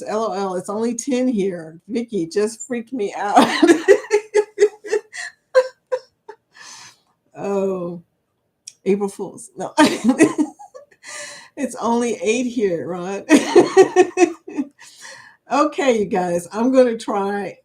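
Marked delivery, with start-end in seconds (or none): 3.4-5.3 long, loud real laughing; a rolling burst of laughter. Real, not acted. Laughing at chat joke. 13.3-14.6 loud real laugh; a short loud burst of laughter. Real, not acted. Laughing at chat joke.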